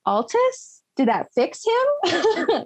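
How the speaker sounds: high, slowed worried asking; anxious artificer voice. Retold or imitated. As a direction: ask it slowly, high and hopeful. Worried question about Altus.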